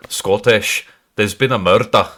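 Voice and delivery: hammed-up Scottish catchphrase; Scottish accent. Shown as a demonstration. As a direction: put on a stock Scottish accent. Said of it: "Scottish is dead easy".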